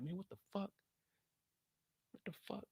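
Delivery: Vic's hushed stunned muttering; hushed disbelief. Retold or imitated. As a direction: mutter it under your breath in shock. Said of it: "when he's talking like this".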